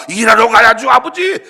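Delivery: a hint of a sulky son, loud; elder son answering. Elder son to father, lightly voiced reply. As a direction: toss it back at father.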